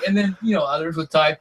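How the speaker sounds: normal talking voice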